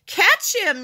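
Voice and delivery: high, loud urgent shout; yelling. A narrator's reading. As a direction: yell for help catching the dog. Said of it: "yelled Henry".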